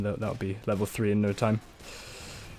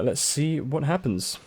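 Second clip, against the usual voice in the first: ordinary talk, nothing marked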